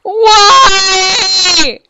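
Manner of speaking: high, loud wail of despair; anguished cry. Real, not acted. Mourning the lost hardcore world.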